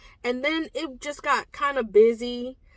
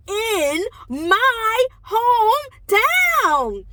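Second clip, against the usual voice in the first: emotional emphasis; 9 semitones higher, 6 dB louder, much slower than usual. Real, not acted. Moved excitement, slow.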